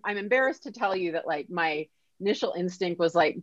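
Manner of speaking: normal talking voice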